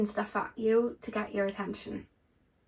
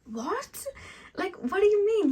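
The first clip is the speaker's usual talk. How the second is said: a playful spirit guide who can't believe it; mock astonished what; incredulous disbelief; 11 semitones higher than usual.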